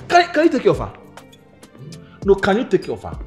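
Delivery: high, loud, falling questions; scornful challenge. Acted. Woman challenging man to provide for her, loud and scornful. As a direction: pitch it high and fire it at him.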